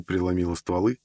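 ordinary delivery, nothing marked

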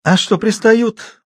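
slow, high teasing question; mocking question. A narrator's reading. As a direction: tease with a stretched, lifted pitch. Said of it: "насмешливо спросил Жуков".

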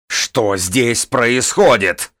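медленно, высоко и громко, властно; прогреми, как штормовая волна; громоподобный голос. A narrator's reading. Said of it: "раздался громоподобный голос". (громоподобный властный окрик)

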